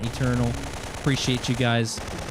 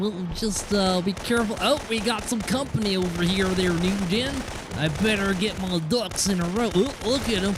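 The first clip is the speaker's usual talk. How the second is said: higher put-on game commentary voice; put-on character voice; 5 semitones higher than usual. Shown as a demonstration. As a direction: keep up a high, silly character voice. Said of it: "talk like this".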